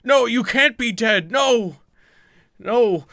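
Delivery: high, loud wail of grief; anguished cry. Acted. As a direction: cry out in desperate, hammed-up denial. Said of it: "the guy cries out".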